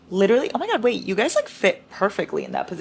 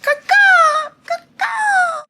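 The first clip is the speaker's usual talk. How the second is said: high, loud screeching crow caw; ear-splitting crow screech; mating bird call; 8 dB louder, much slower than usual. Real, not acted. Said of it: "I do my mating bird call again".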